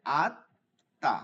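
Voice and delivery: আত্তা with the তা doubled and held long, slowly. Shown as a demonstration. Teacher showing how a shadda doubles the letter. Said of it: "ভেঙে ভেঙে পড়া যাবে না".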